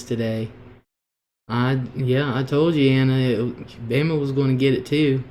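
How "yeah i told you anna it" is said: ordinary talk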